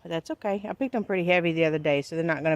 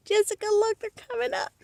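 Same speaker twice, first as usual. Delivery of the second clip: much higher, thrilled calling out; excited. Real, not acted. Gardener calling Jessica over, excited and high. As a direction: squeal with delight at the sprouts.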